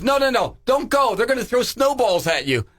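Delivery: mock-urgent kid brother pleading; warning brother. Brother warning him off the snowballs: high, urgent kid voice. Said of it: "my brother goes".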